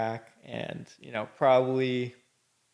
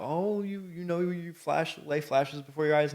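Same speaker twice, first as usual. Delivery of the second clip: higher mock-dreamy voice sending up the cliché; sarcastic sing-song, slowed on the middle; lightly mocking the near-death story; 5 semitones higher than usual. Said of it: "there's all these stories about".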